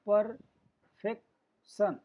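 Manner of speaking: emphatic, drawn-out syllable demo; in three parts. Shown as a demonstration. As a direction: pause after each syllable of perfection. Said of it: "तीन part में उच्चारण करने".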